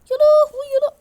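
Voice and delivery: very high, loud shriek; panicked shriek. Real, not acted. Gamer caught by sudden danger, shrieking in shock. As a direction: yelp in shock.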